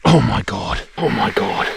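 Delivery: slow awed gasps; stunned by the size of it; amazed. Real, not acted. Big fish hooked, amazed.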